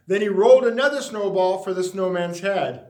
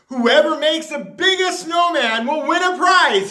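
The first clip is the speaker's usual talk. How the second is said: a high, loud town-crier call; mayor's announcement; 7 semitones higher, 5 dB louder than usual. A narrator's reading.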